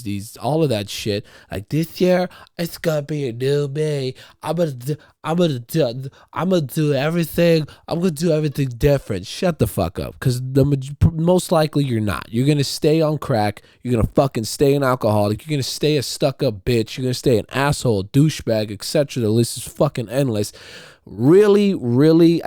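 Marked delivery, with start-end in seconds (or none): 1.7-9.2 high mocking voice of a social media poster; mocking quote. Mocking new-year-resolution posters. 21.1-22.4 loud, high double really; emphatic. Real, not acted. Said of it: "emphatic outburst".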